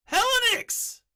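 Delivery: high, very loud yelled greeting; excited yell. Real, not acted. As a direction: yell it right into the mic, thrilled to see a friend. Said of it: "I just yelled into the mic".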